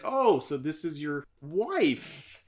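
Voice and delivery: slow, loaded question stretching "wife"; pointed disbelief. Retold or imitated. Teacher hears the supposedly divorced man introduce his wife, pointedly. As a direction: draw it out as a mock accusation.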